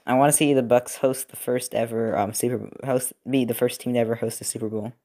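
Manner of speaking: ordinary talk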